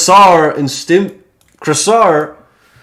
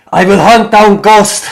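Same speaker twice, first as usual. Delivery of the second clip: loud, raised in-character oath; fierce acted declaration, higher and louder; vengeful vow; 8 semitones higher, 7 dB louder than usual. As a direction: swear revenge at full voice.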